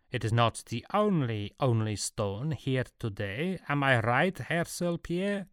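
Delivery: put-on affronted Swiss German accent; slow Swiss German retort, rising high at the end; multilingual affront. A narrator's reading. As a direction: hit back, offended, in Swiss-German-accented English. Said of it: "from behind his multilingualized affront".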